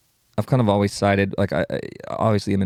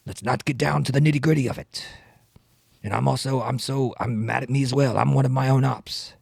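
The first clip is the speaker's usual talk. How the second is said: put-on senator's accent; defensive politician, lightly hammed up; Bernie Sanders. Politician admitting he is rich, put-on voice. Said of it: "rapping Bernie Sanders".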